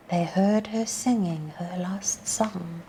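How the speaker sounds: hushed whisper for her last song; quiet whispered line. Acted. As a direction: let it fall to a whisper.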